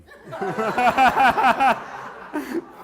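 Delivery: loud high burst of laughter; real roaring laugh. Real, not acted. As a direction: crack up. Big laugh at punchline.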